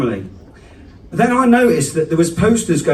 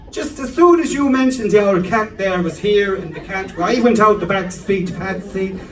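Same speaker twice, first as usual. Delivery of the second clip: cheery mum; 5 semitones higher than usual. Retold or imitated. Mum hands back the cat.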